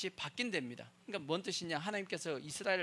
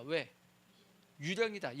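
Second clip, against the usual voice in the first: ordinary delivery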